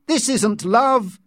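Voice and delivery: high, loud, stretched-out shout; angry shout. A narrator's reading. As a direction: shout it in outrage, leaning on the words. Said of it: "John shouted".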